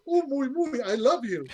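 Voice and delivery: high-pitched cuddly alien coo; Jar Jar Binks. Retold or imitated. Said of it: "speaking like Jar Jar Binks".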